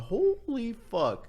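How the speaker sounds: high, drawn-out awestruck exclamation. Retold or imitated. Restaurant worker recalls spotting a famous streamer beside him, awestruck. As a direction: stretch it out high, reliving the amazement.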